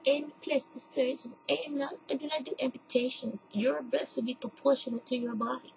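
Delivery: a hint of a stern doctor talking down to a patient; quoting the doctor. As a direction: mimic the surgeon's lecturing tone, understated. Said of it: "He said".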